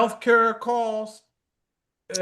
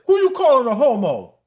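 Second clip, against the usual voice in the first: sassy retort; 5 semitones higher, 5 dB louder than usual. Retold or imitated. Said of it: "I was like".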